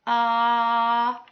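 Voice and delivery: ah held long and open; a long wide ah for the dragon; saying ahh. Shown as a demonstration. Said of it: "Can you say".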